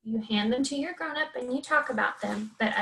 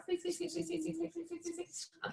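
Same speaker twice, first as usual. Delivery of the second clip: higher, softer mouse squeaks; tiny mouse squeaking quietly; playful squeaks for a superpowered mouse; high, softer squeaky mouse; 4 semitones higher, 13 dB quieter than usual. Shown as a demonstration. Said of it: "It's got superpowers".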